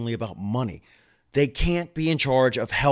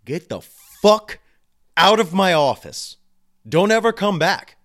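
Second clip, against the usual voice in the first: furious yell at patient; 7 semitones higher, 8 dB louder than usual. Pretend. Doctor snapping at a hopeless patient, furious loud yell. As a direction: throw the patient out of the office, shouting. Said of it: "hasn't wanted to say to their patient".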